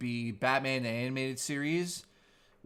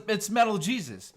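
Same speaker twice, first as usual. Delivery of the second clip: raised, louder mock host voice; imitating his opening line; Metal Jesus intro; 6 semitones higher, 4 dB louder than usual. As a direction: parody of another host. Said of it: "stole Metal Jesus's intro".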